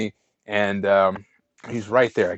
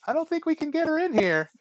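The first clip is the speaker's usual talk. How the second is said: rising, higher and higher excuses; nervous refusal; 10 semitones higher than usual. Retold or imitated. Teller nervously telling the hitchhiker the truck is too small, pitch rising. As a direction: squirm out of it with a climbing voice.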